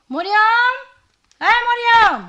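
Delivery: calling out. Acted. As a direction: call the maid from across the house. Calling the maid from afar. (গলা চড়িয়ে ডাক)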